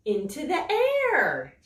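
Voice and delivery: slow, expressive read-aloud for little ones; storybook reading. A narrator's reading. Teacher reading a rain book to young children, expressive storytelling. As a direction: savour each line like bedtime story.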